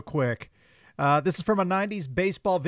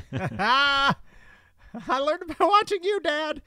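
high mock kid voice; 8 semitones higher, slower than usual. Quoting the anti-drug ad.

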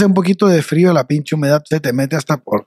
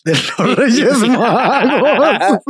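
laughing; 6 semitones higher, much slower than usual. Real, not acted. Laughing at their own naivety.

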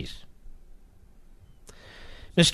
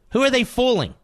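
incredulous; 10 semitones higher than usual. Real, not acted. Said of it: "host mocks party".

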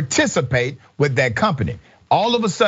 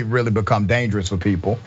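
ordinary talk, nothing marked

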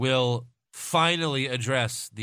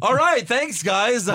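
news reporter voice; 10 semitones higher, 7 dB louder, slower than usual. Retold or imitated. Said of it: "she does a report on the air".

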